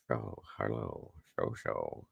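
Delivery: low, slow, distant imitation; mimicked voice. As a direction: do someone's low drawling greeting. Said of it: "who I'm mimicking when I do that".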